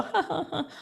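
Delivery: high, soft fake chuckle; mock laugh. Speaker mimics people laughing along at self-insults. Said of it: "people could smile at you and go".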